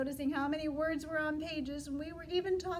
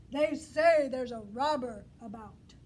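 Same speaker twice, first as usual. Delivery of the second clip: a bit louder, like a watchful guard; guard bee voice; 3 semitones lower, 8 dB louder than usual. Acted. Teacher voicing the guard bee, warning loudly. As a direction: warn them like a guard on duty. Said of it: "Did you hear that voice? The guard voice?".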